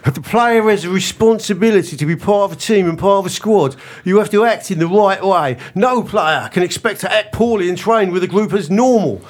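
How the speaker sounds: a light mock Cockney manager's voice; Eddie Howe in Cockney. Quoting the Newcastle manager to Kev in light Cockney. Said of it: "let's pretend he's".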